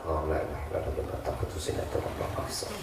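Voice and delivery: whispered recitation, barely voiced; under the breath. Shown as a demonstration. Preacher shows praying silently at a nagging wife, whispered. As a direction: murmur it as if inside your head. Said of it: "Dalam hati".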